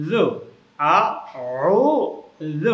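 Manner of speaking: careful syllable-by-syllable আ, ও, জ; tongue-tip dhal. Shown as a demonstration. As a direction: squeeze ও, put the tongue tip to the teeth for জ. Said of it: "জিহ্বার আগা থেকে উচ্চারণ".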